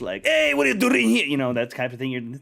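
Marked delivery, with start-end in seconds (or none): 0.3-1.2 high, loud mock Scottish yell; yelled Scottish accent. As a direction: holler a greeting in a put-on Scottish accent. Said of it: "yelled at you in, in Scottish".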